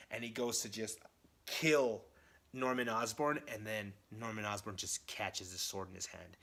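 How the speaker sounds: ordinary talk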